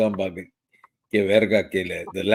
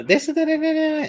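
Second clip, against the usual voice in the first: high, chirpy mock receptionist voice; imitating her phone voice. Husband mocking wife's work phone voice, high and chirpy. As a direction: mimic her sing-song work greeting way up high. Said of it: "And she'll say".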